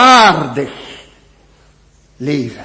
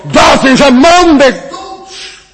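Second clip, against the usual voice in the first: outraged cry; 10 semitones higher, 7 dB louder than usual. Acted. King David crying out at the man who took the lamb, loud and outraged. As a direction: thunder out the death sentence. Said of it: "roept David dan uit".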